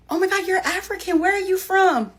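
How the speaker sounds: imitating an admirer. A stranger complimenting her, high and gushing. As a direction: gush like someone impressed by her looks. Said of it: "and goes".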